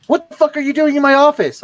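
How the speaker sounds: yelled quote. Retold or imitated. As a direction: bark it like a furious sergeant. First sergeant yelling at him.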